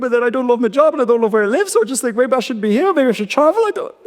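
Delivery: a hint of the worried girlfriend; girlfriend's voice.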